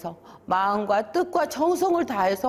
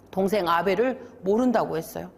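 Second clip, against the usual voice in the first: ordinary delivery, nothing marked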